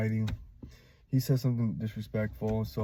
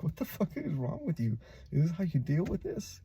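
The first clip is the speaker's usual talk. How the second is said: higher-pitched imitation of disbelieving buddies; mock incredulous; 6 semitones higher than usual. Buddies confronting him for laughing about Kevin, mock incredulous.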